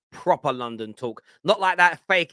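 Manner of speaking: usual speaking voice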